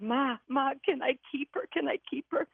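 put-on high-pitched child begging mom; imitated child's begging, voice way up; pleading. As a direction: re-enact an eager kid's plea. Child begs mother to keep dog.

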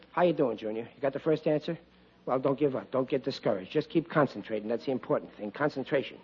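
soft, low encouragement murmured to Junior; hushed coaxing. Acted. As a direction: keep it down so he can concentrate. Hushing so Junior can concentrate.

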